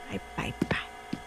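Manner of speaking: breathy whisper, slow and soft; whispered goodbye. Acted. Customer whispering goodbye as she leaves. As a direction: whisper the farewell barely audible.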